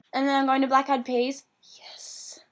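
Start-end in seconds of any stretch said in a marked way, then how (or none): 1.8-2.4 hushed, breathy confidential aside; whispered aside. Real, not acted. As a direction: whisper it like a secret boast. Whispered aside while listing concerts.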